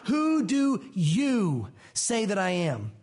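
slow, with "Who" stretched and weighted; deliberate emphatic "Who" question; stress on who. Shown as a demonstration. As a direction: ask it slowly, pressing on "Who". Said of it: "that word is emphatic".

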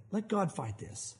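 hushed realization; quiet, slow resolve. Real, not acted. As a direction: let it go gently. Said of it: "wait a minute".

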